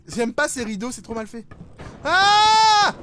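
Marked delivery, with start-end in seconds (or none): 2.0-2.9 sudden high loud scream; startled scream. Real, not acted. Jump scare.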